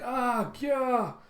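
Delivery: high imitated cries of panic; hammed-up crowd shrieking; mock screams. Crews under falling ink needles, high mock screams.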